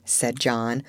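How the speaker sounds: plain reading voice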